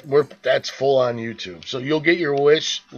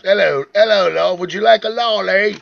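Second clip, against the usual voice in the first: higher, louder cheeky British voice; mock British accent; 6 semitones higher, 5 dB louder than usual. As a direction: offer a lolly like a hammed-up Brit.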